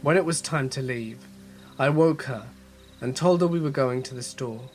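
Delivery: ordinary delivery, nothing marked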